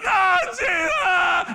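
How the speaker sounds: high loud splutter; mock panicked cub cry. Cub thrown in the pool, mock panic. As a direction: splutter in the water. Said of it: "he's like".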